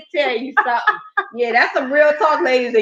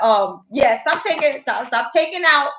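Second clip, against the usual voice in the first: normal talking voice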